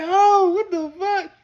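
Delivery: slow, high, cracking cry of joy; overwhelmed. Retold or imitated. Son overwhelmed seeing his released father. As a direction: overcome at the sight.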